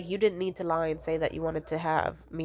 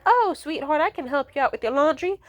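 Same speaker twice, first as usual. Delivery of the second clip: high, louder imitation of an eager mom; sweetly offering mom; 9 semitones higher, 5 dB louder than usual. Mom offering her child help with laundry, high sweet imitation. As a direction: play a mother gushing an offer. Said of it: "my mom to be the type of person to be like".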